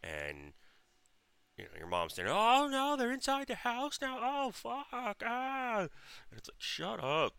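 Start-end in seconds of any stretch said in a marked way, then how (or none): 2.4-5.9 very high falsetto panic; mock mom screaming. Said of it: "if you stop screaming". 6.6-7.3 higher, louder mock scolding; retold irritated order. Snapping back at screaming mom.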